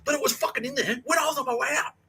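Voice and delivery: sustained high, strained yelling; furious arguing. Retold or imitated. Student arguing with housemaster about his name, furious. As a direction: rant back without letting up. Acted shouting match.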